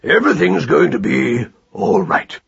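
weighty, sonorous assurance; solemn, confident reassurance; authoritative. A narrator's reading. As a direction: declare it with measured authority. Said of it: "most authoritative tones".

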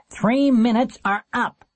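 high, slow, taunting call; nasty, drawn-out shout from the steps. A narrator's reading. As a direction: call time on them nastily. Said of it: "in a nasty voice".

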